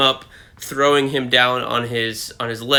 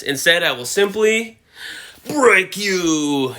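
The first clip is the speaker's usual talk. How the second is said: put-on higher, theatrical Russian villain accent; Russian-accented Bane; 5 semitones higher, slower than usual. Bane threatening to break Batman, Russian accent. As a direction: deliver the threat in a mock Russian accent, drawing out the end. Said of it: "I did a Russian voice for Bane".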